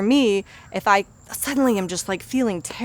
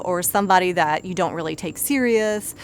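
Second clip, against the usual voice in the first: ordinary talk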